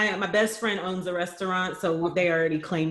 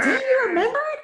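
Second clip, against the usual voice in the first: very high, laughing squeal of surprise; mock disbelief; 4 dB louder than usual. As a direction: squeak it as a caught-out parent. Mock dismay at child's memory.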